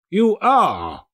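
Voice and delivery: high mock-stern echo; feigned stern Scrooge. A narrator's reading. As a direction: pretend to be outraged. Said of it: "repeated Scrooge".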